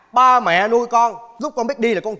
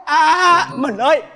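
higher, louder frantic call to his wife; 6 semitones higher, 4 dB louder, slower than usual. Acted. Husband cheering his wife through childbirth, loud anxious call. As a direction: cry out in a panic for her. Husband calls during wife's labour.

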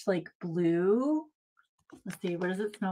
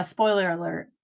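normal talking voice

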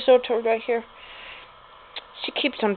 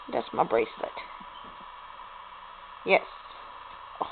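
normal talking voice

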